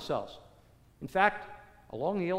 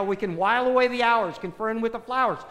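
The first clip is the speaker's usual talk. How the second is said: ordinary talk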